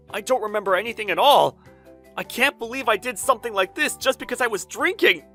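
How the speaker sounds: loud, high self-reproach; panicked dismay. Acted. Designer horrified by his drunken night, panicked dismay. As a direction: wail in dismay at yourself.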